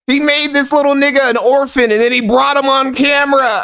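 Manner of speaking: very high-pitched, scandalized disbelief; hysterically amazed; incredulous outburst. Real, not acted. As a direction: exclaim the whole line high, as if you can't believe it. Incredulous reaction to the clip.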